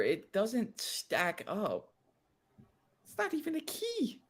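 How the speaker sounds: high, soft, slow whiny voice; mock whine. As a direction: concede in a squeaky whimper.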